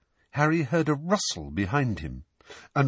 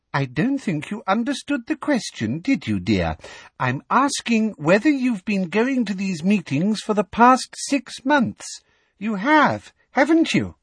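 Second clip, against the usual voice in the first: Umbridge voice; 10 semitones higher, 3 dB louder than usual. A narrator's reading. Umbridge pressing, high.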